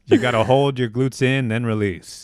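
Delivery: a hint of a loud coach; gym coach voice.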